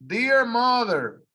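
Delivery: slow, careful reading of Dear mother; slow model reading for learners to repeat; read slowly. Shown as a demonstration. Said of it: "I'm gonna read it slow first".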